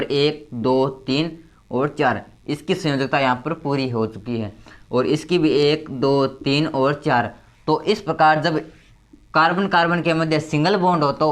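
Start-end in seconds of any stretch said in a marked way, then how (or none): 0.0-2.2 slow counting. Shown as a demonstration. Teacher counting valences on board. 5.5-7.3 slow counting. Shown as a demonstration. Teacher counting valences on board.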